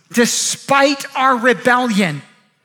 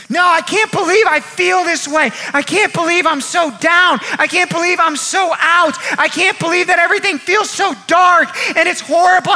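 mock wailing; 3 semitones higher than usual. Preacher acts the man suffering in his tent, mock wailing. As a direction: wail each complaint out from the pulpit. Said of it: "he's just wailing, and he's like".

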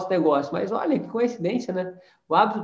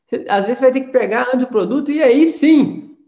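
loud, higher, pushing rebuke; heated rebuke; 4 semitones higher, 6 dB louder than usual. Acted. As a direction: shake up the lazy listener. Heated rebuke of passive members. (cobrando a equipe em tom alto e indignado)

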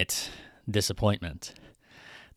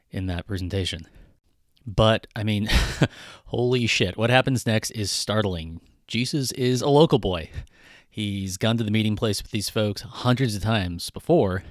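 ordinary delivery

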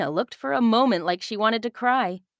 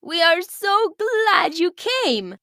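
higher, slow welcoming leader's voice; glad village leader; 11 semitones higher, 4 dB louder, slower than usual. Acted. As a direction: welcome the pirates with slow delight. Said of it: "said the woman".

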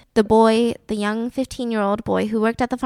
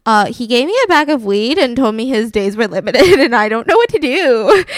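flustered attendant; 6 dB louder than usual. Retold or imitated. Attendant panicked.

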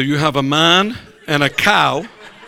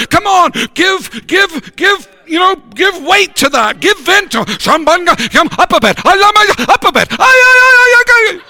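fiery shouting preacher; preaching at full force; 14 semitones higher, 6 dB louder than usual. Acted. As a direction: preach it hard and loud.

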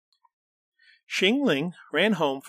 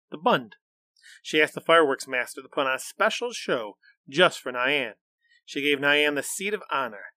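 usual speaking voice

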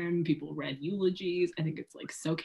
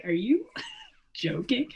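laughing mock disbelief, drawn out; retold disbelief; 7 semitones higher, slower than usual. Retelling a spat over accents to a co-host, mock disbelief. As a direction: retell it incredulous, slowly, through a laugh. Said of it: "I was like".

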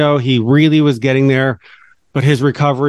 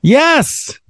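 excited yes; 14 semitones higher than usual. Real, not acted. Cheers at right answer.

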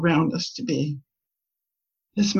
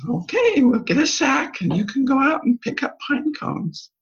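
higher, bright cheery grandma agreeing; cheery okay; 8 semitones higher than usual. Retold or imitated. Grandma agreeing to the pine cone deal, cheery high voice. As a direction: sing out the okay to the child. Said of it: "And I said".

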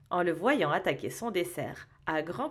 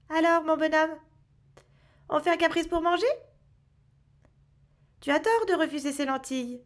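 higher, quick, teasing fake scolding; mock-angry elf; 6 semitones higher, faster than usual. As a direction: scold him like a playful grumpy elf. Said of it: "d'un air faussement fâché". (faussement fâché, voix de lutin)

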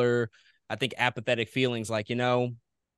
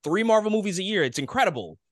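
high-pitched, thrilled retelling of fan amazement; wowed, higher-voiced imitation of past excitement; excited fan; 8 semitones higher, 4 dB louder than usual. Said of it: "we would be like".

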